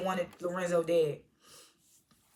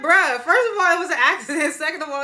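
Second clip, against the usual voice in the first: high, loud, incredulous objection; retold outburst of disbelief; exasperated protest; 6 semitones higher, 6 dB louder than usual. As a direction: argue back at the characters, worked up. Her exasperated reaction to the plot.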